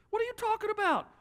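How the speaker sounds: high, rising scold from a put-out boss; annoyed boss. Acted. Boss scolds an employee back late from lunch, a rising high scold. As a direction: bark him back to his desk, pitch climbing.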